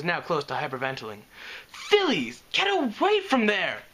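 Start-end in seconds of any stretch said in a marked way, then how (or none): 1.7-3.8 much higher panicked call; shrill the warning to the fillies; shrill cry. Acted. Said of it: "she shrilled".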